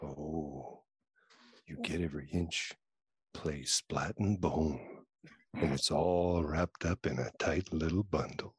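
sexy voice. Pretend. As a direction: read it low and seductive like a crooner. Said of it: "do it in your sexy voice".

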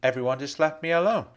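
plain reading voice

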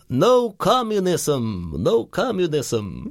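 put-on high, drawn-out accented proclamation; slow nightclub local. Traveller imitating a dreadlocked guy in a Bratislava nightclub, slow drawn-out proclamation. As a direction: stretch each word out slowly, like a dazed chant.

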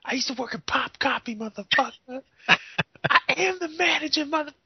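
high, squeaky mock boast; hammed-up coworker bragging; mock gangster boast. Mocking a coworker's tough talk, high squeaky boast. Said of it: "He's like".

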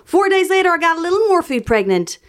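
high-pitched mock Southern voice; amateur Southern accent. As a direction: read it as a high, playful Southern belle. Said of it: "I don't know what the Southern accent is".

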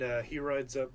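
ordinary delivery

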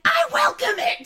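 high laughing shout; laughing mock shout. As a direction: belt it out while cracking up. Retold defiant reply, laughing.

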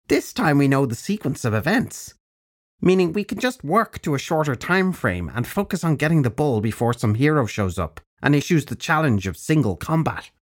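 put-on high, bright-edged old witch; old crone voice. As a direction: speak as a brisk old crone. Crone character voice.

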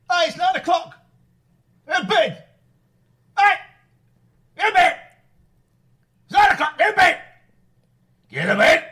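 loud high barking yell; shouting over telly. Acted. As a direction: bark orders at the kids. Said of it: "You were literally barking then".

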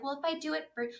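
a hint of Maisie's twang, cut short; Maisie impression. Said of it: "Finally, we found your impression".

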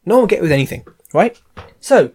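normal talking voice

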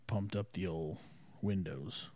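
ordinary talk

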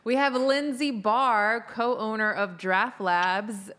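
announcer voice. Acted. Announcing the guest.

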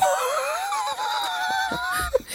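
high hooting laugh at the dark joke; shrieking laugh. Real, not acted. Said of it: "You gotta go there".

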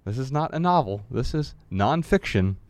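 measured book reading voice; reading from his book. Acted. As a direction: read the passage aloud, deliberately. Reads his book aloud.

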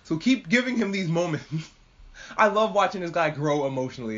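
normal talking voice

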